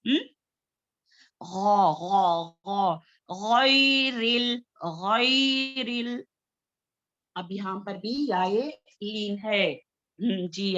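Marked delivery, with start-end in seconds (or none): 1.4-6.2 गैरिल with a full, heavy ghain, slow and drawn out; heavy letter drilled slowly. Shown as a demonstration. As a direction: stretch गैरिल slowly with a thick throaty ghain. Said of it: "गौ heavy letter है".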